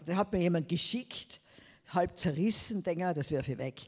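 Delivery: ordinary talk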